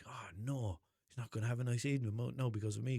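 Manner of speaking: low, soft mock lament; sarcastic mock regret. Driver ridiculing the guard's imagined regret, sarcastic mock lament. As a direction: quietly pretend to be crushed.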